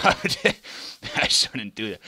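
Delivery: loud, slow laughing line; embarrassed laugh. Real, not acted.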